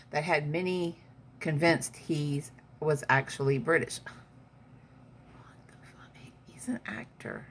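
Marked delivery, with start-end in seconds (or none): 4.0-7.5 breathy whisper under her breath; whispered aside. Real, not acted. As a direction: mutter it as a secret aside. Aside reacting to the article.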